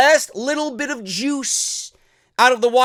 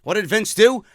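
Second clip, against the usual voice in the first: ordinary delivery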